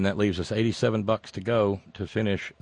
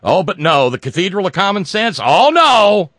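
much higher, much louder sarcastic cry; sarcastic outburst; 7 semitones higher, 11 dB louder than usual. Real, not acted. Host mocking the congregation, rising to a loud sarcastic shriek.

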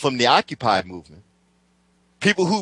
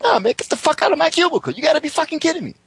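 retold blowup; 12 semitones higher, faster than usual. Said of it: "And I'm like".

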